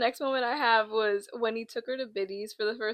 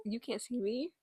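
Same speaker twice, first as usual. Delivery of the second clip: soft, hushed little dog voice; mock dog voice; 12 dB quieter than usual. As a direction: play the dog that thinks it's hidden.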